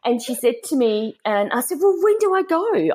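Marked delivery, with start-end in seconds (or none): 1.8-2.9 high, eager question; retold excitement. New recruit asks mum about leaving, excited. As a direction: pitch it right up, keen to be off. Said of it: "I was so excited".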